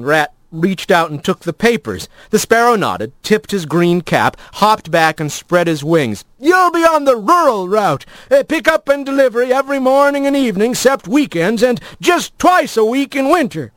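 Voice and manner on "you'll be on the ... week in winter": high, excitable mail-carrier bird; chatty old postbird. Acted. Old postbird tells Rat of the long search, high and chatty. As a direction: play a talkative bird postman, pitched way up.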